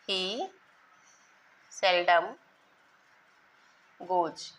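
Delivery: each word slow, with long pauses between; teacher dictating slowly with pauses; word-by-word dictation. Shown as a demonstration. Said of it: "देख लीजिए example".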